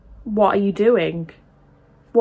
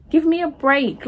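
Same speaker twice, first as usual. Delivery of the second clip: scoffing; 7 semitones higher than usual. Real, not acted. Scoffing at pro-lifers.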